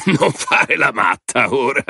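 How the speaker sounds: high, drawn-out snickering tease; chuckling. A narrator's reading. Man tells woman not to act crazy, chuckling. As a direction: tease her through a chuckle, stretching the words. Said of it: "disse Tommy ridacchiando".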